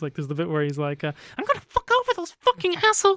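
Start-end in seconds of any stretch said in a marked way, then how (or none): 1.4-3.2 put-on very high-pitched childish tantrum; mock tantrum. Acting out the villain's tantrum over his enemies, high-pitched fury. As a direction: imitate a squealing, petulant fit. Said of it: "and he has tantrums".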